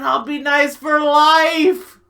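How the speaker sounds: high, loud wail of dismay; panicked outburst. Acted. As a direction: build to a shout on the last word. Panic at the thought of marriage.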